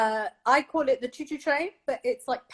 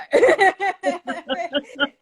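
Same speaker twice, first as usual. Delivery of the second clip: laugh; 7 semitones higher, 8 dB louder than usual. Real, not acted. Laughs after the hiss demo.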